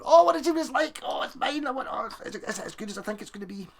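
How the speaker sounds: high, breathless gushing over a new guitar; mock-gush like a kid with a new toy; giddy excitement. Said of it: "I wouldn't be sitting there going".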